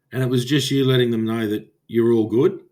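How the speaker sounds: normal talking voice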